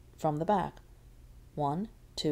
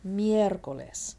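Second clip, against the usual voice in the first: slow "Miércoles" with a strong first syllable; stress on MIER; slower than usual. Shown as a demonstration. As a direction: stretch "Miér" in "Miércoles".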